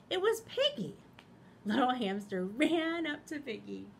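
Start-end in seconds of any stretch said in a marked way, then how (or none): 0.1-1.0 bright announcement. A narrator's reading. Narrator announces new character.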